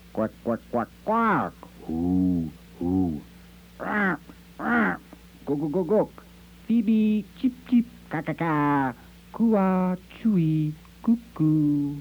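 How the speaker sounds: high chirping squawks, one bird after another; bird calls. Acted. Birds of America answer with high bird calls. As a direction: call out like a flock of ducks, owls and songbirds. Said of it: "Said the birds of America".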